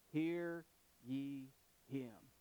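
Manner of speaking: spaced out word by word; slow, weighty, with long pauses between words; solemn divine command. Acted. God's solemn command from heaven.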